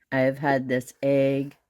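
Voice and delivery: usual speaking voice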